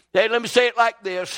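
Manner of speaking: normal talking voice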